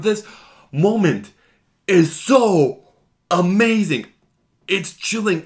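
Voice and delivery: genuinely thrilled, slow and lifted; raving awe. Real, not acted. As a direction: savor every word like it gave you chills. Raving about favorite moment.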